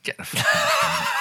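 high squeaky slowed disbelief; incredulous outburst. Real, not acted. Reacting to his friend's absurd sensor-bin gears claim, incredulous high squeal. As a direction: shriek it out slowly in disbelief.

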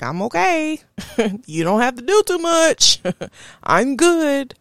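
high, breezy laugh through the words; high, laughing reassurance to worried friends. Retold or imitated. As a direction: wave them off with a light chuckle. Brushing off upset friends, laughing.

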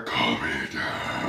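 very low, slow monster voice; monster stepdad. Acted. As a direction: drag it out deep like a monster. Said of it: "He goes".

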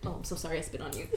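usual speaking voice

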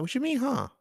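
high-pitched mock indignation; indignant comeback. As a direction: throw it back in a high, acted voice. Retold argument with coworkers.

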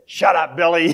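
loud, high mock-scolding yell; joking shout. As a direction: yell back at a heckling mate in jest. Preacher retorts to his heckling friend.

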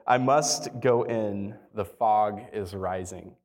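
slow, drawn-out dramatic quote; quoting solemnly, very slowly; dramatic last words. Retold or imitated. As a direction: draw it out with mild drama. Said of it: "She's always so dramatic".

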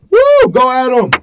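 loud cheering shout-out; hype shout. Real, not acted. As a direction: whoop it up for a buddy. Hype shout-out for credited writer.

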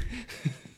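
a short high burst of laughter. Real, not acted. Podcast host laughing at his mock quote, high laugh. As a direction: laugh at your own joke.

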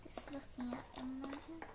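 soft unvoiced whisper. Real, not acted. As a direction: whisper it to yourself. Quiet searching in game.

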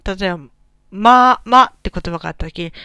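ordinary delivery

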